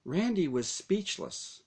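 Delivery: ordinary delivery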